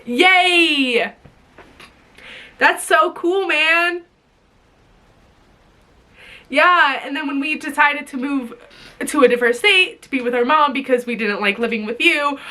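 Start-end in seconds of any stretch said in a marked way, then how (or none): none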